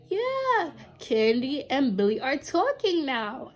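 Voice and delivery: dishing the news with relish; gleeful gossip. Retold or imitated. Pink reveals Candy's secret, gleeful reveal. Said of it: "she's like".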